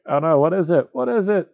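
high, mock-frightened Scrooge; hammed-up panic. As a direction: overplay a scared old miser, pitch climbing. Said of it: "And Scrooge is like".

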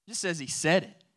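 ordinary delivery

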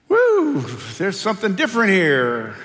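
loud, high, delighted whoop; excited exclamation. Acted. Adam waking to find the woman, loud delighted whoop. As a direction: hoot with wonder at something new. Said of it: "the man exclaimed".

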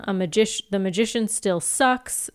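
normal talking voice

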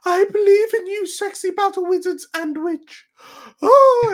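very high, loud princess voice; foppish princess. Acted. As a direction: gush like a fluttery princess.

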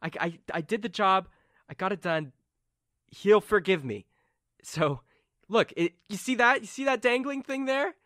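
high-pitched, pleading insistence; insistent Carmen. Acted. Carmen assures doubting companions she did the job, insistent. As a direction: plead to be believed, pitched well up. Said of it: "And she's like".

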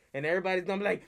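usual speaking voice

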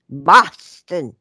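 attempted Boston accent. Shown as a demonstration. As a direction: try "Boston" the way a Bostonian would. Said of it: "I can't even say it".

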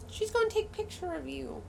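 high, cooing pet voice. Real, not acted. Owner telling her pet about the photo, high baby talk. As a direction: talk sweetly up high to the animal.

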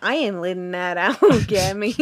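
hammed-up American accent with a laugh. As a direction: play a silly American, laughing. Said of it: "That's an American accent, by the way".

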